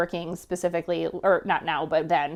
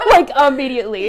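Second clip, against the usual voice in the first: higher, louder, laughing it out; real laughing remark; 6 semitones higher, 11 dB louder, faster than usual. Real, not acted. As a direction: burst into a laugh mid-word. Laughing over calling mother.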